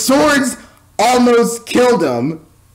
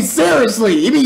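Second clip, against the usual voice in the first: ordinary delivery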